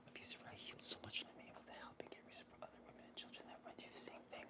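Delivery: barely audible whispered reading; reading to herself. Real, not acted. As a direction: whisper a message under your breath, moved. Reads viewer's message to herself.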